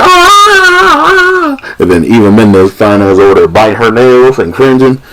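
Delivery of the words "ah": high-pitched mock scream; imitated scream. Man roughed up in torture scene, high mock scream. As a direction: shriek like the man being hurt. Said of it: "And then he's like".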